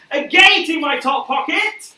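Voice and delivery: high, loud, slow punchline; excited reveal. Acted. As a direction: shout out the reveal. Mock reveal of a gate.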